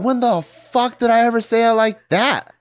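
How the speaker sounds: high, incredulous mock outrage; exasperated retort. Daughter mimics her exasperated reply to mom, high and incredulous. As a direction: act out snapping back at mom. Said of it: "I'm like".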